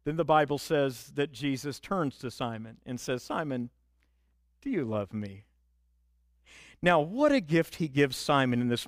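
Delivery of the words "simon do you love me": soft and low, gently asking; tender hushed question. Acted. Jesus quietly asks Simon if he loves him, soft and tender. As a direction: ask it quietly, like a wound you're touching.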